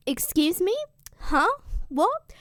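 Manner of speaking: rising pitch with each question; outraged disbelief. Retold or imitated. Hyperion hears his daughter's news, in shocked disbelief.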